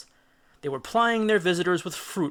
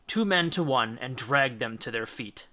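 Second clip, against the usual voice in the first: ordinary delivery, nothing marked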